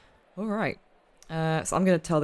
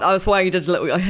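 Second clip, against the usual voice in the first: ordinary talk, nothing marked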